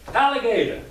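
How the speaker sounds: high, loud call to someone in the water. Acted. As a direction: call out for the alligator. Rabbit calls alligator from the bank.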